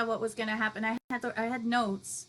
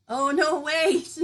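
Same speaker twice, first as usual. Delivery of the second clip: louder, high, slowed startled cry; surprised; 5 semitones higher, 8 dB louder, slower than usual. Real, not acted. Host startled reading a viewer nearly fainted, surprised. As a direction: stop short in alarm.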